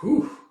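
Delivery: high, rising hoot; impressed whew. Acted. Spectator impressed by a throw, high whew. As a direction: let out an amazed "whew".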